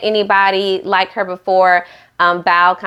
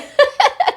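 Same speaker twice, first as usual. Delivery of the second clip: high squeal of a laugh; real shrieking giggle. Real, not acted. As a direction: squeal with delight. Laughs after Bao's jab.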